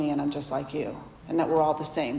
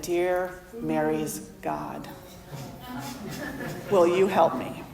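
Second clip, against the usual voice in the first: humble prayer; 5 dB quieter, slower than usual. Retold or imitated.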